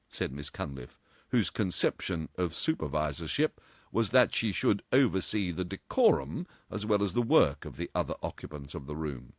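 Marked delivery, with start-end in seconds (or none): none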